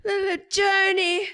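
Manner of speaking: put-on high, slow old woman's cry; old lady yelling. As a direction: call out like a crone after a traveller. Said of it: "She's like".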